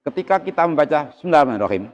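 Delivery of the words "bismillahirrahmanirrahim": Bismillahirrahmanirrahim rushed with no clear letters; hurried, garbled Bismillahirrahmanirrahim; slurred Basmalah. Shown as a demonstration. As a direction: swallow the sounds of Bismillahirrahmanirrahim, like "semenarmanrohim". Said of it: "itu yang jelas ndak ada".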